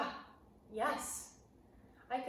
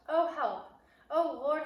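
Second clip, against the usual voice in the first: 1 frightened plea; 6 semitones higher, 8 dB louder than usual. Acted. Boy terrified in hiding.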